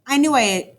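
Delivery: plain reading voice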